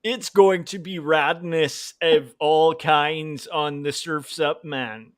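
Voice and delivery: slow, careful mock Californian accent; overcareful surfer slang with a European accent; European faking American. European promising radness, badly faked American accent. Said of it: "a little off, like, it can't be perfect".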